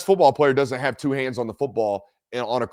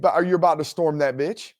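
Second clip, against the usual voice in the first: usual speaking voice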